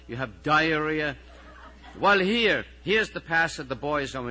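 exasperated teacher. Acted. Teacher dealing with sick and squabbling pupils, exasperated.

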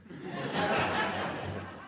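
amused laugh without words. Real, not acted. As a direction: laugh at your own joke. Tickled by own joke.

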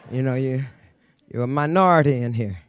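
ordinary talk, nothing marked

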